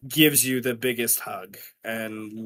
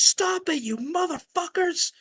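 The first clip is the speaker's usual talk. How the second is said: wife shouting. Retold or imitated. Wife shouting over the gang to stop the fight, high furious yell. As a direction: cut through the brawl like an angry mom. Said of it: "your wife's voice that says".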